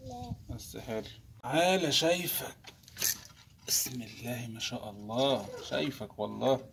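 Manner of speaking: low murmured coaxing to the fish; hushed coaxing. Real, not acted. Angler murmuring to a hooked fish, hushed and coaxing. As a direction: softly sweet-talk the fish onto the hook.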